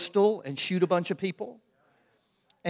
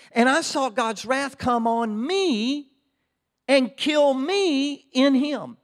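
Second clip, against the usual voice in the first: impassioned preaching; 8 semitones higher, 4 dB louder than usual. Real, not acted. Pastor testifies his vision of the cross, impassioned high preaching.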